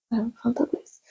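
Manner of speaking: very soft, whispered order; timid whisper. Retold or imitated. Customer ordering from a frantic wee woman, timid whisper.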